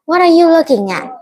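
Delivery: high-pitched imitation of a rude challenge. Stranger confronting a girl who looked at them, rude. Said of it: "sometimes they can be rude".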